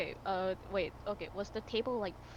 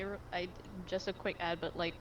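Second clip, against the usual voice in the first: ordinary delivery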